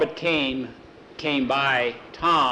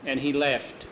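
usual speaking voice

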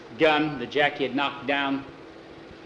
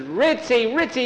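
put-on high, raised boyish call; 11 semitones higher, 5 dB louder than usual. Boy running towards his dog, calling out high.

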